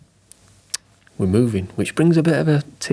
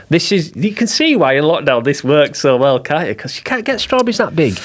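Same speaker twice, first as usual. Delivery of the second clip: excited; 6 semitones higher than usual. Real, not acted. Excited about huge berries.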